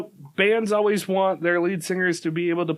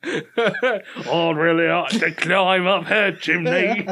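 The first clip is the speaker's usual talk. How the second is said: slow, laughing fake cockney; slow bad cockney, laughing; bad cockney accent; slower than usual. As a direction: laugh through a hammy cockney. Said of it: "Yours is bad enough".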